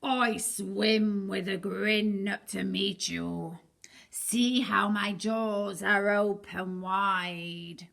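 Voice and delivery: slow, hushed, sly shark; creeping shark invitation. Acted. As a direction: lure them in, whispering slowly. Shark lures listener.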